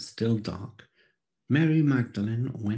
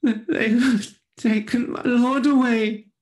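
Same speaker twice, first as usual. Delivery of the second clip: high, loud, pleading woman's voice; grieving Mary; 12 semitones higher, 8 dB louder than usual. A narrator's reading. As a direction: voice a desperate Mary near tears. Mary answers the angels.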